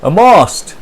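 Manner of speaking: loud high delighted yell; dad's loud yell. Acted. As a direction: shout out the discovery. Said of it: "yells the dad".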